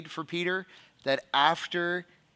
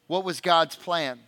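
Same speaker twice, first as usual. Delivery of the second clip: ordinary delivery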